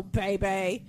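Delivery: ordinary talk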